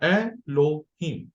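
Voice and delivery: एलोहीम broken as ए, लो, हीम; slow teaching split of एलोहीम into three beats; syllable by syllable. Shown as a demonstration. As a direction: separate each syllable of एलोहीम for learners. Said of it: "एक एक syllable करके पूरे लफ्ज के आपने टुकड़े".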